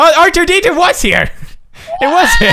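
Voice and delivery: high, loud and excited; sudden high excited outburst; excited exclamation. Pretend. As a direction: shout it out in glee. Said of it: "Great impression".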